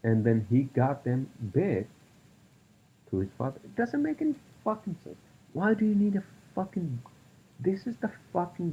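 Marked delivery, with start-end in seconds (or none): 3.6-8.7 high-pitched outraged rant; real outrage boiling over at guns; angry rant. Real, not acted. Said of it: "I don't want to fucking go on a rant".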